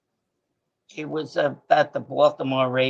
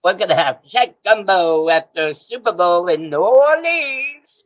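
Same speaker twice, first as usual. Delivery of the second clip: a slow, hammed-up Cajun accent, higher and louder; Cajun accent impression; 4 semitones higher, 6 dB louder than usual. Caller asked by the host for his Cajun accent, mock Cajun accent. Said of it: "your Cajun accent impression".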